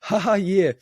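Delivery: high, put-on "ha ha"; put-on polite laugh. Passenger humouring the flight attendant, with a high put-on laugh. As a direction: fake a little laugh, then agree. Retelling his feeble reply to attendant.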